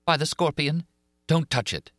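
a hint of Christine calling back; young woman's voice. A narrator's reading. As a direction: warn him as a frightened girl. Christine answers from other room.

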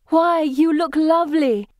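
bright high call across the garden. A narrator's reading. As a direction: call out delightedly from afar. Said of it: "Pippa shouts to us".

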